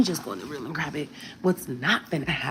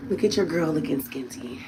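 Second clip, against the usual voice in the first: ordinary talk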